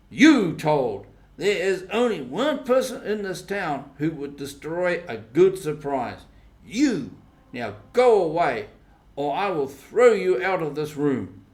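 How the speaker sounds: loud, indignant accusing outburst; angry accusation. A narrator's reading. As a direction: throw the blame at him and order him out. Tom angrily accuses Sid.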